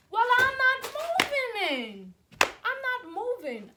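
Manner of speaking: high, loud, slow mocking whine of the roommate. To viewers, mocking the roommate who won't move, high loud whine. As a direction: whine it back like the stubborn roommate. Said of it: "She was like".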